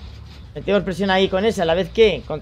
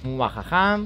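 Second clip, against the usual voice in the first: evil laugh; 7 semitones lower, 7 dB quieter, much slower than usual. Pretend. Gamer fakes villain laugh.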